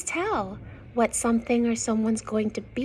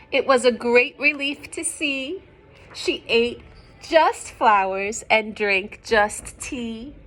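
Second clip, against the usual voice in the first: clear, louder storybook reading; story narration; 8 dB louder than usual. Acted. Reading a picture book to young children, lively narration. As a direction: read the rhyme out to the children.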